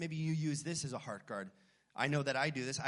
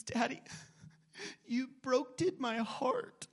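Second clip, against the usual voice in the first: put-on little girl voice; 8 semitones higher than usual. Dad imitating his weeping daughter Vivian, high and childlike. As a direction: mimic a tiny hurt child. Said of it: "and she says".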